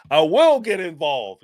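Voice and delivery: ordinary talk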